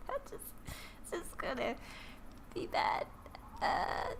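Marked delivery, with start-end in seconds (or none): none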